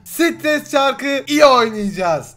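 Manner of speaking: slow, loud stretched title call; drawn-out announcement. Acted. A gamer announcing the game to viewers, loud and slow. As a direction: stretch the game name out like a showman.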